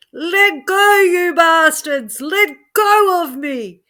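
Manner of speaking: very high, loud furious scream. Acted. Joe fighting off the aliens, screaming furiously. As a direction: yell at them to let go. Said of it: "he screamed for all he was worth".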